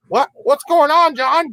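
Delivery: scared regular. Retold or imitated. Terrified customers asking the clerk what happens, high scared squeak. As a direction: squeak it out like a nervous onlooker. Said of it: "So they're like".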